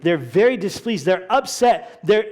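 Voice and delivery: usual speaking voice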